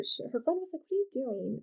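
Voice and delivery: usual speaking voice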